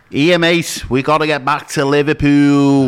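hammed-up Scouse robber; mock Scouse accent. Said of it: "they put on fake accents".

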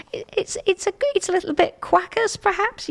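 giddy laughing delivery of a silly pun. Real, not acted. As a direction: laugh through your own pun.

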